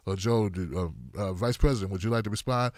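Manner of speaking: moderator. Retold or imitated. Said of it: "the moderator was like".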